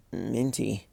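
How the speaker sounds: soft, dazed mumble; quiet mumble; mumbled. A narrator's reading. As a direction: murmur it half-dazed. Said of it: "Twilight mumbled".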